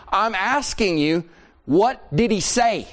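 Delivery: slow, high, insistent demand; exasperated demand. Acted. Wife demanding husband report the teaching, exasperated and insistent. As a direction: spell it out word by word, exasperated.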